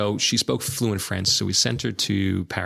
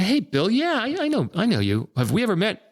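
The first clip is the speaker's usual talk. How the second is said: much higher, eager greeting; bright mock surprise, pitched way up; retelling his own friendly surprise, much higher; surprised phone greeting; 12 semitones higher than usual. Retold reply on the phone.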